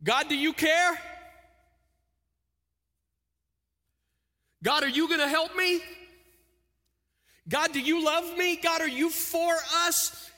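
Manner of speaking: high, loud questions to God; desperate prayer. Retold or imitated. Worried parent questioning God, high and loud. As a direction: cry out, pleading for an answer.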